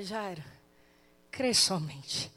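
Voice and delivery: Jesus softly reassuring Jairo after raising his daughter; tender Jesus. Acted. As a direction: say it gently over the bed. Jesus speaks to Jairo at the bed.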